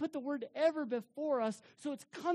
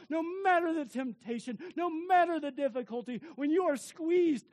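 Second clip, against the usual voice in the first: rising, louder preacher's build; preaching build; 2 semitones higher, 5 dB louder than usual. Acted. Preacher driving the point home, building loud. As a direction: build each phrase stronger.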